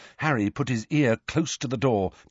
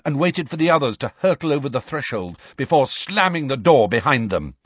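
plain reading voice

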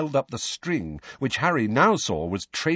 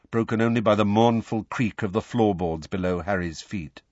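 usual speaking voice

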